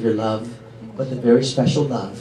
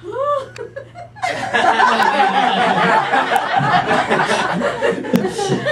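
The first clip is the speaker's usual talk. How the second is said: laugh. Real, not acted. Laughs at ring joke.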